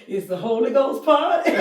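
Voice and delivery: high, loud laughing dad voice; daddy quoted. Retold or imitated. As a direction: play daddy proudly correcting her, laughing. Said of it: "Daddy said".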